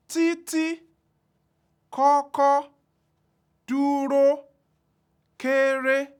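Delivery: títí, kọ́kọ́, dúró, kéré each sung out on a high level tone; every syllable of títí and kọ́kọ́ held high and even; high tone words. Shown as a demonstration. Teacher demonstrating Yoruba high tone, high and level.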